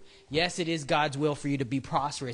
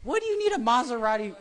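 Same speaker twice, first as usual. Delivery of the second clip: high, fast scoffing question; incredulous; 8 semitones higher, faster than usual. Retold or imitated. Answering the car prayer with a high scoffing question. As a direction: scoff at the request. Mock retort to greedy prayer.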